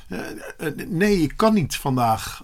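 halting start with false starts; hesitant. Acted. Boy turning down his friend, hesitant.

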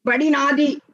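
put-on high, loud childish claim; acted loud cry. A narrator's reading. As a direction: call it out forcefully like an insistent boy. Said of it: "అని గట్టిగా కేకేశాడు".

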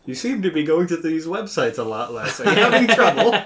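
high, loud, laughing through a fake assistant voice; laughing mock assistant. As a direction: crack up mid-line as a teasing assistant.

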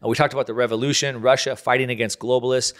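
ordinary delivery

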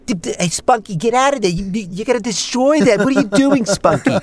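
high, stammering comic impression; Woody Allen. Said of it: "So try Woody Allen".

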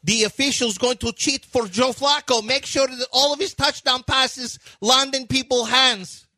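put-on high, singsong Russian-accented grievance; aggrieved rant in a hammed-up Slavic accent; Darko. Coach accusing officials of cheating, high mock Russian accent. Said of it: "Your Darko impression is".

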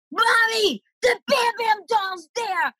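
put-on a three-year-old yelling the miracle to mom; excited child shout. Child discovering doll, calling mom.

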